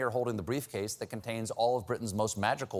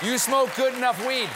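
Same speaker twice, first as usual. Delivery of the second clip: high, loud scolding; 10 semitones higher, 5 dB louder than usual. Acted. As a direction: yell it at the stoners. Lecturing stoners.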